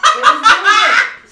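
high, loud laughing burst; laughing outburst. Real, not acted. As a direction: gush it out while laughing. Laughing outburst about show.

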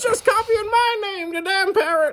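very high parrot squawk, complaining. Acted. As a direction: screech it like the parrot. Parrot voice again.

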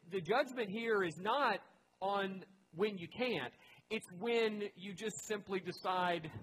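high, loud, slowing preacher's push; preaching at full force. Acted. Pastor pressing his congregation, preaching at full force. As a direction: drive the point home from the pulpit.

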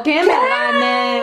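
drawn-out, high mock shout; mock indignant reaction. Classmate reacting to "gánate", mock indignant. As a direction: stretch it out high in pretend outrage.

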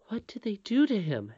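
a touch of a frightened princess; trembling. Acted. Princess asking about the goblins' victim, mildly trembling. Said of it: "asked Irene with a trembling voice".

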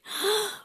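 sharp gasp sucking in air; big in-breath. Acted. Host leading kids' breathing exercise, big gasp. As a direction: gulp in all the air around you.

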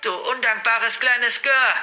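slow, high raging insult; drawn-out venomous high yell; furious scream. A narrator's reading. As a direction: scream it like an enraged grandmother. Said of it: "schrie sie".

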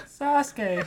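Sakura. Retold or imitated. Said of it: "she is just like".

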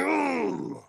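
high mock whimper. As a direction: whimper like a hurt kid. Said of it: "I'm like".

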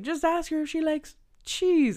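high put-on girly voice. Friend quoting Viola's advice in a high girly voice. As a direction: coax him sweetly in a girly voice. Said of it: "she's just like".

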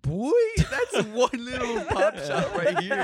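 high, loud, slow laughing line. Pretend. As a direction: laugh it out big. Joking about a little shop.

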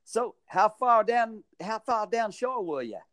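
put-on a mangled Australian accent for a local hotel guy; slow, drawn-out, badly faked Australian voice; butchered Australian accent. Local hotel guy on the beach asks, in a butchered Australian accent. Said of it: "I'm gonna butcher this accent".

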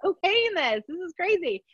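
doctor doubting her own okay, half laughing; incredulous doctor. Retold or imitated. Doctor marvels she's okaying this, laughing. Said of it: "even my doctor was like".

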